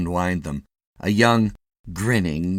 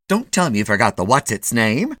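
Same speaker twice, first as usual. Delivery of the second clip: imagined nagging wife; 4 semitones higher, 7 dB louder than usual. Retold or imitated.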